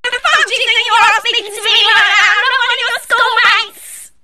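furious mother, high and loud; angry mum. Acted. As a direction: scold like an outraged mum. Acted mum scolding daughter.

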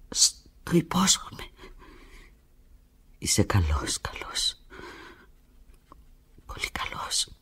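slow, hushed whisper. Acted. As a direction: breathe the promise softly. Whispered promise on phone.

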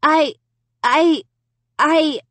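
high, halting stammer; sputtered. A narrator's reading. Girl lost for an answer to sister, sputtering. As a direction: sputter the word in separate high bursts. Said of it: "I sputtered".

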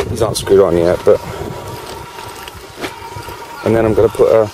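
talking round a cigarette; words muffled by a cigarette; cigarette in mouth. Real, not acted.

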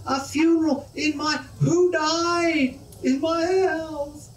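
high, slow, near-crying wail; high, drawn-out cry of a man beside himself; distraught man. Acted. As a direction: wail it out in horrified disbelief.

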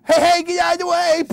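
high, loud and fast alarm; warning shout. Acted. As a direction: yell to clear the path. Riding a goat wildly.

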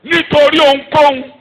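loud, slow, high preaching; preaching at full force. Acted. Preacher proclaiming to the church, loud and high. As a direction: proclaim it to the congregation. Preacher declaring prayer loudly.

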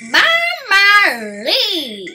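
booming, laughing pirate greeting; bandit shout. A narrator's reading. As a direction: bellow it out like a swaggering pirate raccoon. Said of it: "shouted the bandit leader".

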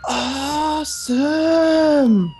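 slow, stretched high awesome; drawn-out awesome. Real, not acted. Teammate praising the plan, slow stretched awesome. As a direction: stretch the word way out.

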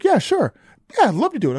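high-pitched retold keenness; eager acceptance. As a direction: jump at the offer, voice pitched right up. Eagerly accepting a movie offer.

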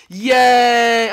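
high, loud shout of joy; celebratory cheer. Real, not acted. Gamer celebrating his race win, high loud cheer. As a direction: cheer your own win at full voice.

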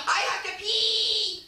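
high, slow whiny cry; imitating audience. Comic mimicking bored audience faces, high slow whine. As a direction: whine it up high like a desperate kid. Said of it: "looking at me like".

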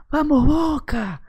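high, drawn-out mock shout; imitated fan yell. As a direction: stretch out a crazed fan's cheer. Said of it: "gritaba el loco".

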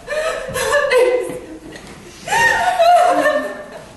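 bright burst of real laughter; laughing. Real, not acted. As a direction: break into a high laugh. Laughs after own remark.

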